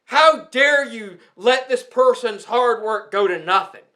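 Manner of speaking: normal talking voice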